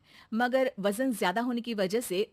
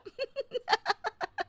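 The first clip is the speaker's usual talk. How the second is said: a high amused laugh; light amused laughter; 13 semitones higher, 3 dB quieter than usual. Acted. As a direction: burst into a high giggle. Anuradha laughs at joke.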